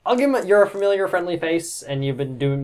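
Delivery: usual speaking voice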